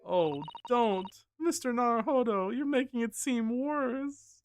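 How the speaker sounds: high, drawn-out pleading voice; pleading assistant. Acted.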